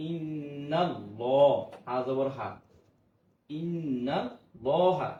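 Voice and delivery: ইন্নাল slow, with the nun held in ghunna; drawn-out ইন্নাল. Shown as a demonstration. Teacher reciting slowly for the class with a held nasal. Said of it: "লা হা, তাহলে হবে না".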